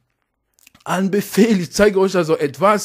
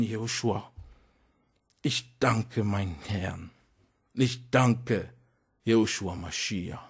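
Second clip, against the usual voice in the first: hushed closing prayer of thanks; 4 semitones lower than usual. Real, not acted. Preacher turns to prayer.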